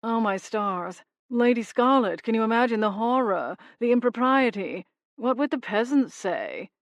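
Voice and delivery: mock-horrified English gentleman impression; mocking English accent. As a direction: ham up a posh English toff in mockery. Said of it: "his best impersonation of Hayward's accent".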